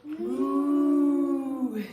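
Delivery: playful sung moo; mooing cow. Pretend. Teacher mooing with children in cow pose, playful moo. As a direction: moo like a happy cow for the kids. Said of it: "Can we say moo?".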